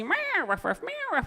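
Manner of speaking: high meows and barks in a squabble; cat and dog fight noises. Acted. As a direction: play the cat and dog scrapping.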